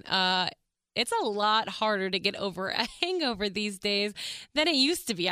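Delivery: usual speaking voice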